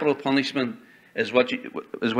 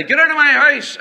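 high, loud shout at an intruder; acted shout; 11 semitones higher, 6 dB louder than usual. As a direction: cry it out to scare off a burglar. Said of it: "if you cry after them and say".

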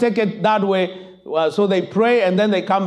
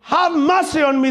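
high, raised pleading cry; 8 semitones higher than usual. Acted. Blind man crying out to Jesus, raised plea. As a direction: beg for mercy aloud. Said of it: "He's saying".

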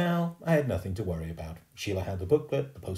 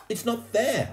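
put-on high, raised kid's protest; indignant complaint; 5 dB louder, slower than usual. A narrator's reading. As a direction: complain to a classmate, pitch climbing. Boy complains to Sheila.

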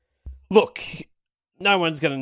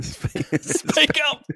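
mock demand; 13 semitones higher, 3 dB louder than usual. Mum cannot hear whispering guest.

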